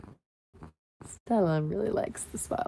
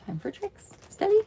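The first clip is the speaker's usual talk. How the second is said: coaxing pet; 14 semitones higher, 4 dB louder than usual. Real, not acted. Coaxing cat to do tricks.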